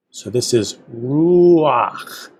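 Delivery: "ruach" slowed, both vowels gliding into one syllable; teacher slowly showing how "ruach" glides its vowels together. Shown as a demonstration. "ruach" drawn out slowly for students. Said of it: "You say both vowels together".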